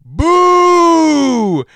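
long, high, booming mock shout; hammed-up drawn-out villain yell; Phantasm Ravenger boo. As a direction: bellow it high and drag it out. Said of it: "not even like, "I'm a scary," like, "Boo," but like".

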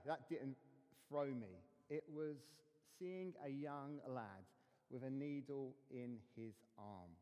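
normal talking voice

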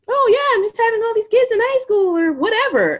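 mocking airhead voice. Mother mocking how doctors see her, high sing-song parody.

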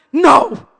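loud, slow, emphatic refusal; defiant shout. Retold or imitated. Mom refusing to give up, loud and defiant. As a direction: refuse loudly, stretching every word.

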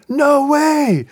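high, loud burst of astonishment; excited disbelief. Retold or imitated. Seatmate hears the author's son is beside him, excited disbelief. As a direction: imitate a seatmate's amazed outburst. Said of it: "so he's all excited".

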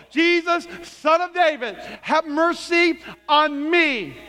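high, impassioned cry. Acted. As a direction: cry out over a crowd, slowing at the end. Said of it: "we gotta get louder than the crowd".

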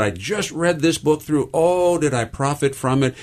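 lightly voiced elderly lady, high and eager; a hint of an eager ninety-nine-year-old; excited old lady. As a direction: relay her delight at the book, lifting up. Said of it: "with excitement".

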